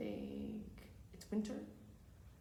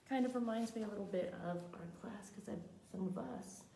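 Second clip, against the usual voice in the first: plain reading voice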